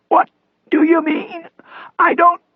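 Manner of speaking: thin, whispery strained rasp; raspy voice. A narrator's reading. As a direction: rasp it out, struggling for voice. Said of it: "Cross rasped".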